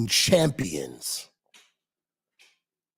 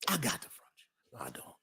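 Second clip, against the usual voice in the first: whispered tease; 14 dB quieter, faster than usual. Real, not acted. Playful teasing aside.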